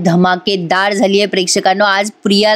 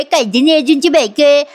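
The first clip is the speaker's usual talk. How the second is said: higher, exaggerated mocking whine on "कायद्याने अर्जुनची बायको आहे"; hammed-up mimicry; 4 semitones higher than usual. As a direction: ham up her old line. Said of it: "तू मला नेहमी ऐकवायचीस".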